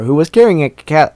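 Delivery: ordinary talk, nothing marked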